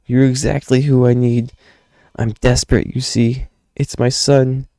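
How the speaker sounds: high, pleading character voice; desperate father. Acted. Father begging a stranger to rescue a son, high desperate pleading. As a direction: plead urgently, pitched well up. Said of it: "Don't mind my voice".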